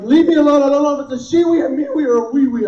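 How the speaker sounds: a hint of a cornered husband barking back; flustered outburst. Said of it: "I'm like".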